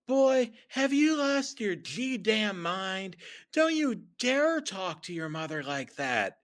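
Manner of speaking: hammed-up motherly outrage; outraged mother. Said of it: "she sounded more like a Southern".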